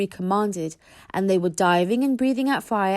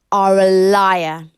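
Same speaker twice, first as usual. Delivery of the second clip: hissing dragon; 10 dB louder, slower than usual. Acted. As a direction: hiss it like a stalking cat. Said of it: "hissed Fireworm".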